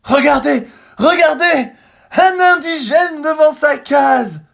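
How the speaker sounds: very high, very loud, slow, amazed; marvelling cry. A narrator's reading. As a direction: gush over everything. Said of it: "s'écrie Zigomar qui s'émerveille".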